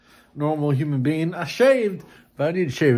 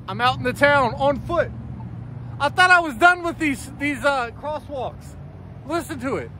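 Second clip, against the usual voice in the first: high, raised outdoor voice; exasperated complaint; 13 semitones higher than usual. Real, not acted. Complaining outdoors about talking crosswalk.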